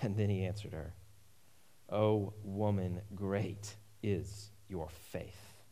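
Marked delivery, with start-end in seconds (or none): 1.9-5.6 very slow, low and soft; hushed admiration. Acted. Jesus commends the woman's great faith, slow and hushed. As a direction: draw it out gently, word by word.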